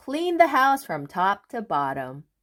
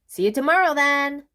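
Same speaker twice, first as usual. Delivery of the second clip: high, louder farewell; cheery goodbye; 8 semitones higher, 4 dB louder, faster than usual. Acted. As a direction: call it brightly. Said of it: "said Mister Busy".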